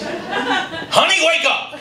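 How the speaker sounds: high-pitched, comically panicked plea; mock-manly panic. Husband wakes wife about the living doll, high panicky squeal. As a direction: meant as firm and manly, comes out high and squeaky. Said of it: "in a loud, firm, masculine voice".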